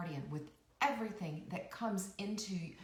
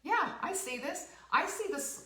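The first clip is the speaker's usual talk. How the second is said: mock eager; 6 semitones higher than usual. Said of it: "You have to just say".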